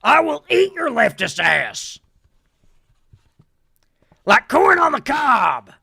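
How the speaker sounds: building to a slow, mock yell; Alex Jones rant. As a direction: chew out the leftists with mock fury. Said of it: "Alex Jones impression".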